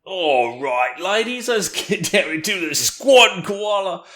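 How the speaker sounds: high, slow hammed-up Aussie instructor; mock Australian fitness coach calling out moves; host doing a friend's Australian yoga teacher bit, hammed-up Aussie. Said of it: "Australian yoga teacher character".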